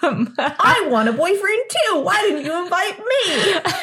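put-on high Brooklyn girl voice, laughing; whiny Brooklyn complaint; Brooklyn accent. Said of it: "you gotta do it in a Brooklyn accent".

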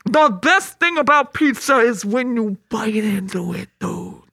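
drawn-out goofy coach voice; Coach Steve voice. Pretend. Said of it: "you sounded like Coach Steve".

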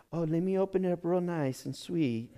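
soft, prim mock nicety; lightly mocking delicate voice; dainty gift opener. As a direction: mimic a fussy careful unwrapper.

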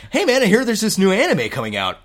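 high, loud mock-eager announcement; hammed-up podcast chat. Host demonstrating a new podcast style, mock-eager. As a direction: pitch it up like exciting news. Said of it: "that's how we need to do the podcast".

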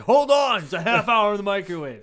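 high, loud mock outburst; mock exasperated shout. Roommate fending off someone hungry for potatoes, mock exasperated shout. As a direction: shout it like a harried cook. Acting out the impatient roommate's answer.

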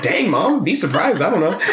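high, laughing jab at mom; laughing retort. Retold or imitated. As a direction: tease her through a laugh. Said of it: "And I was like".

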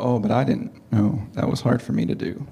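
mock sheepish excuse, dropped low; sheepish admission. Each of us admitting to ourselves, a lightly voiced sheepish excuse. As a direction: mumble it like you've been caught out. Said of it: "look at ourselves and go".

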